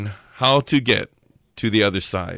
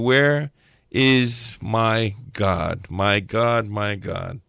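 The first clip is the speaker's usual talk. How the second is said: despairing plea; 2 semitones lower, much slower than usual. Acted. Believer sitting on the bed at night, slow fading despair. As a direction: let the plea sink away, acted.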